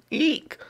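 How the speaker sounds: very high startled squeak; frightened squeak. Acted.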